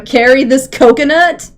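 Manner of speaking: ordinary delivery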